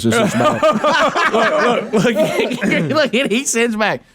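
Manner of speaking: high, giggling through the words; can barely get it out for laughing. Real, not acted. Telling friends about the prank, giggling hard.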